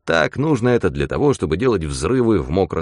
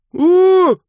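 very high, loud howl; drowning howl; 7 dB louder than usual. Acted. Mitka howls while drowning. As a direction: howl from the water. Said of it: "орал Митька".

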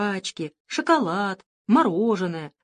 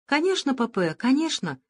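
hurried; 6 semitones higher than usual. Acted. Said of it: "торопливо сказала кукла".

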